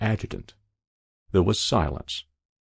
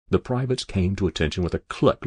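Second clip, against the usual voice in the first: usual speaking voice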